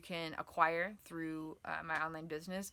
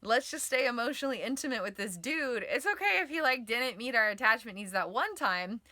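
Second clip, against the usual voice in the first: laughing, higher, louder excuse-making; giddy self-talk; 5 semitones higher, 9 dB louder than usual. Acted. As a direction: coax yourself with a laugh. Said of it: "attached stress response might be over there".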